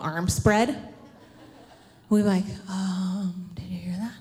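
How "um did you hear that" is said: soft, hesitant whispered question; hushed children's whisper. Acted. Child to sister in the dark, whispering. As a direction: whisper warily, imitating a spooked child. Scared children at night, retold.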